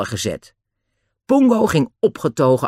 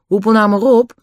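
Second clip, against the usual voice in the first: put-on high, louder boy's voice; unruffled Dick; 10 semitones higher, 4 dB louder than usual. A narrator's reading. Dick advises Den while Pongo attacks, high and cheeky. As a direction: call it over coolly, pitched high. Dick's voice in the story.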